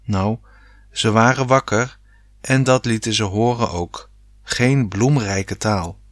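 ordinary delivery

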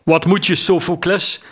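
understated shout from overhead; calling from above. A narrator's reading. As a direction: call down from the deck, mildly. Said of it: "riep een stem op het dak".

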